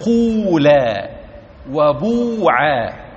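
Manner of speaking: قولا and وبوعا drawn out slowly, with a full long u; teacher slowly demonstrating قولا وبوعا; slow Hudhayl forms. Shown as a demonstration. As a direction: stretch each word slowly to show the long u. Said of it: "نحو".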